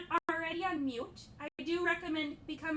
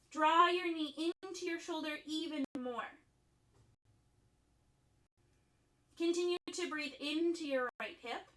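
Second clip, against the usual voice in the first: softened, measured cueing with long silences; guided yoga instruction; 7 dB quieter than usual. Acted. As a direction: guide them through breath and pose, leaving room between phrases. Leads breathing and yin yoga poses.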